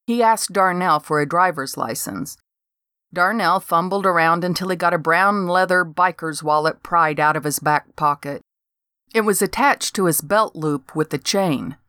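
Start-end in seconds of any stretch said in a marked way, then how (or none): none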